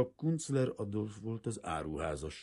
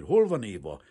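insistent demand; 4 semitones higher, 10 dB louder than usual. A narrator's reading. Kazimír insists again, louder.

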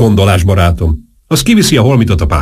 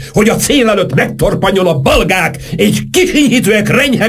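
very high, quavering declamation; quavering oratory. A narrator's reading. Saint-Germain laments his noble struggle to Marcel, voice quavering. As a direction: declaim it like a wounded grand orator, high-pitched. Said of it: "mondta Saint-Germain rezgő hangon".

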